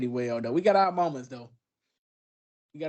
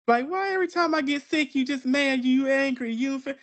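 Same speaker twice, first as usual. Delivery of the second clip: higher, whining complaint; mock whiny partner; 10 semitones higher than usual. Sick partner complaining to him, whiny mock voice. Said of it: "Be mad".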